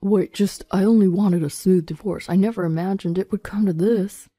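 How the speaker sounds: shaky, pleading man's voice; pitifully shaky. Acted. Husband excuses himself while being arrested, pitifully shaky. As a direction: protest pitifully in a put-on voice. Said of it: "Alden's voice was pitifully shaky".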